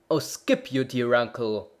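loud, irritated outburst; a touch of an angry bellman; angry exclamation. A narrator's reading. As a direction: snap at him impatiently. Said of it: "the bellman exclaimed as he angrily tingled".

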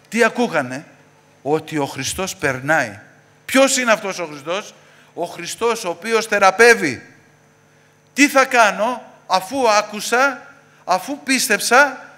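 sermon rising to high, loud questions; preaching, building. Real, not acted. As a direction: build from firm to loud, stretching the last phrases. (κήρυγμα που δυναμώνει, με φωνή όλο και πιο ψηλή)

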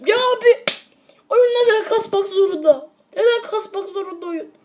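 high, loud exasperated complaint; exasperated yelling. Real, not acted. Gamer yelling at the lagging game, high and exasperated. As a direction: whine loudly at the game.

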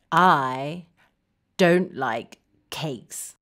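low, downcast and halting; glum dislike. Shown as a demonstration. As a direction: say it glumly, dropping right down. Modelling dislike of cakes.

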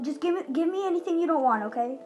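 ordinary talk

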